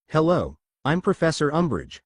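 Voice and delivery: ordinary delivery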